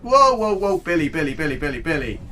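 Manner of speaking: sudden loud whoa, high and urgent; alarmed shout. Real, not acted. Player warning pet Billy about a crash, loud alarmed shout. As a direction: yell a warning to your pet.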